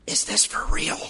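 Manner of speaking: hushed whisper, barely voiced. Wife whispers to husband in the stunned church, hushed. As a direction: lean in and whisper it. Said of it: "she whispers".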